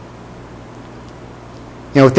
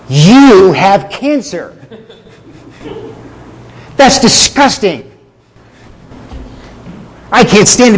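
louder and higher, a sneering doctor disgusted by his patient; mean doctor; 4 semitones higher, 5 dB louder than usual. Retold or imitated. Doctor scorning a patient over his test results, contemptuous and loud. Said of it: "the mean doctor who just points out that you're sick".

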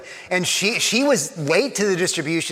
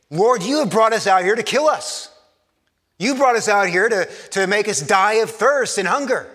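higher, louder blaming cry at God; accusing complaint; 5 semitones higher, 4 dB louder than usual. Shown as a demonstration. Preacher acting thirsty Israelites accusing God, loud and bitter. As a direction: throw the blame at God. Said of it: "Do you hear the difference".

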